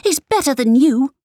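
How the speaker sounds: put-on high, loud, fast child's shout. A narrator's reading. Girl shouting at her mother to defend her father. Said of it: "Kaina shouted".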